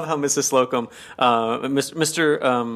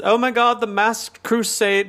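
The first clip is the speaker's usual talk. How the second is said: mock damsel voice; 11 semitones higher than usual. Mister Humphreys mocking Peacock's ski mask, as a swooning damsel. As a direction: gasp it out like a fluttery damsel in distress. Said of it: "he says".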